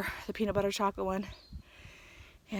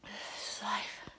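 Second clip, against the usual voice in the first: resigned whisper; 7 dB quieter, much slower than usual. Real, not acted. Resigned repeat, slower and quieter.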